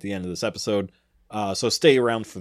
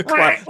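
squeaky, high-pitched duck quack; 4 dB louder than usual. Shown as a demonstration. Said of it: "just bling".

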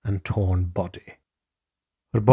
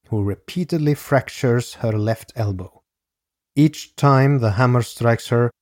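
ordinary talk, nothing marked

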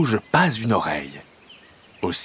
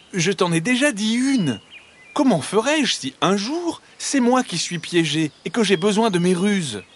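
high-pitched, irked little hedgehog; exasperated, raised-pitch retort; annoyed hedgehog; 9 semitones higher than usual. A narrator's reading. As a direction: answer back testily in a high voice. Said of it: "Agacé, le hérisson répond".

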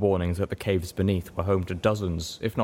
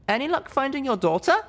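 zebra mare voice. Acted. Littlepip voicing Zenith question.

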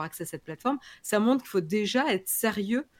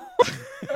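high-pitched laugh. Real, not acted. Laughing after losing her thread.

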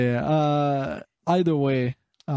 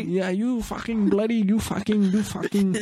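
mock drunk caller; 6 semitones higher than usual.

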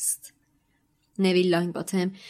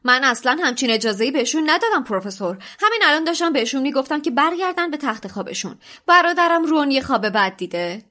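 offended pride; 6 semitones higher, 5 dB louder, faster than usual. A narrator's reading. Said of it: "به غرورش برخورده بود".

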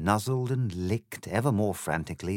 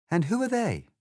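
put-on a slightly higher, prim girl's voice; polite Alice, lightly voiced; 11 semitones higher than usual. Alice politely answers the egg, lightly voiced girl. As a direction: explain it courteously, a proper little lady.

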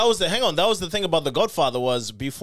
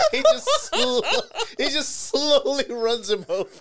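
high, breathless laughing words; barely getting it out through laughs; laughing so hard it turns to crying; crying with laughter; slower than usual. Real, not acted. Telling funny video, crying laughing.

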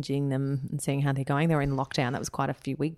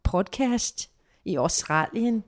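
slow, higher native Danish; speaking Danish; 5 semitones higher, 4 dB louder, slower than usual. Shown as a demonstration. Host thanks podcast listeners in Danish, slow and higher. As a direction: greet the listeners in Danish, slowly. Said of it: "Kai always throws up when I speak Danish".

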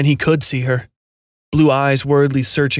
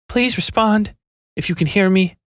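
higher female voice, pleading; worried radio call; 7 semitones higher than usual. A narrator's reading. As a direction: call out anxiously into the line. Operator calling over radio.

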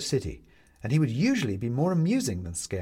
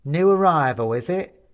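loud, high Cockney jeer; Cockney skeleton sizing up the newcomer, loud and cocky; 5 semitones higher, 8 dB louder than usual. A narrator's reading. Kneeling skeleton quizzing the tiny Benedict, loud Cockney. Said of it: "an incongruously Cockney accent".

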